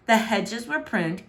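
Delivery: plain reading voice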